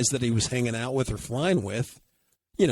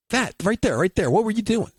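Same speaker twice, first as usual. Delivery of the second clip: high, louder, insistent; excited pointing; 10 semitones higher, 4 dB louder than usual. Retold or imitated. Mike catches the twang, high and insistent. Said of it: "So I'm flying and".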